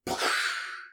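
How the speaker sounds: mouth-made gunshot, a burst of air; imitated gunshot. Friend retelling a film's shooting, imitated gunshot. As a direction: blow out a short gunshot noise. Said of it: "you know, like a gunshot".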